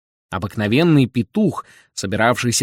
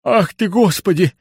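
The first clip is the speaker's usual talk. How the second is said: high, flustered exclamation; agitated old man; 9 semitones higher, 4 dB louder than usual. A narrator's reading. Old man overwhelmed by Bender's dream, high flustered cry. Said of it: "заволновался старик".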